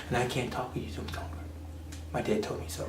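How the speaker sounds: whisper. Retold or imitated. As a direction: whisper it fast like a secret. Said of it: "She can talk very softly".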